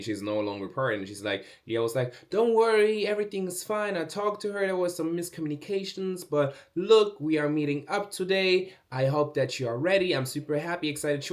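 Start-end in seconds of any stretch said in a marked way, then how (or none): 2.3-11.2 high, sing-song reassuring agent; chirpy reassurance. Retold or imitated. Agent reassuring the model after a mix-up, chirpy. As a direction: bubbly cheer to smooth it over. Leah reassuring her, cheerfully.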